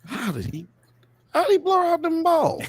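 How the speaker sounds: very high, squeaky mock voice; squeaky incredulous question; high squeaky disbelief. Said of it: "I was like".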